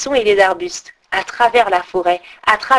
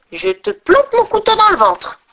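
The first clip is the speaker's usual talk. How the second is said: menace à moitié endormie; 11 semitones higher, 3 dB louder than usual. Acted. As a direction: menace aiguë, à peine réveillée. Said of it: "dit la fille des brigands, à moitié endormie". (brigande somnolente menace Gerda, voix aiguë)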